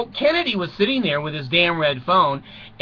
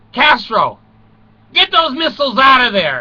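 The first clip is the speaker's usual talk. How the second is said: loud, higher, commanding; mock presidential order; 7 semitones higher, 6 dB louder than usual. Kennedy to Castro, a loud mock command. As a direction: bark the order across the sea.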